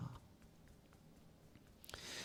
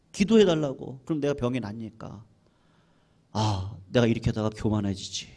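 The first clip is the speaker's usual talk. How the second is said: usual speaking voice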